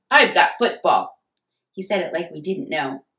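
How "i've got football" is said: talking through a mouthful; mouth full. Acted. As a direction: say it around a bite of stuffing. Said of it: "said with a full mouth".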